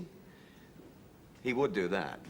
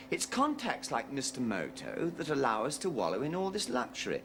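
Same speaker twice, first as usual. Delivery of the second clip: usual speaking voice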